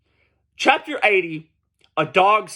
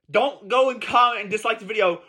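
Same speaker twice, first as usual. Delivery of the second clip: ordinary talk, nothing marked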